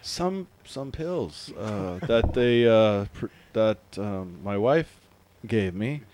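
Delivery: low, slow, halting stoner voice; stoned friend. Retold or imitated. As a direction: drift off between words as if groggy. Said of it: "you know the stoner, the, the friend".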